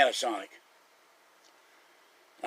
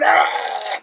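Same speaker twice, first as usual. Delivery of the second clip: mock cry; 7 semitones higher, 6 dB louder than usual. Irwin struck by stingray.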